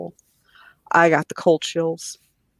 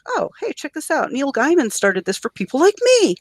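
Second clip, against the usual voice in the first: high, bright-eyed retelling of finding it; thrilled self in a retold moment; excited discovery; 9 semitones higher than usual. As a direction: squeal the news up high to yourself. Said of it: "I'm like".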